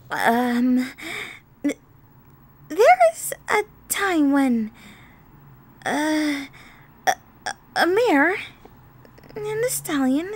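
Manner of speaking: haltingly searching for words; flustered improvising. A narrator's reading. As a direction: stammer awkwardly, stalling with drawn-out ums. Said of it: "tried to think of something on the spot".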